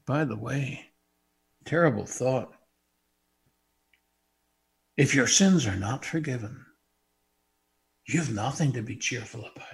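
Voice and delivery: ordinary talk, nothing marked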